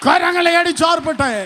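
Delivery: loud, high call to clap hands; rally the congregation, shouting; full-force preaching. Acted.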